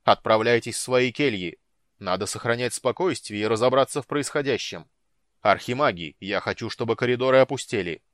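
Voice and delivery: loud stern orders; commanding shout. A narrator's reading. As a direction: command the hall. Head orders crowd to cells.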